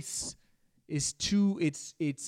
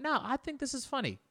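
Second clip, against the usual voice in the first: amused dismissal; 13 semitones higher, faster than usual. Retold or imitated. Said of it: "And I went".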